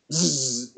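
low, quiet buzzing "zzz"; soft imitated electric sizzle; electric zap. Shown as a demonstration. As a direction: imitate electricity zapping through iron. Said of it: "like that".

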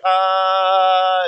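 long held, level "ah"; drawn-out demonstration "ahhh"; echo test "ah". Shown as a demonstration. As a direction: sustain an open tone to test the echo. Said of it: "your voice actually echoes off".

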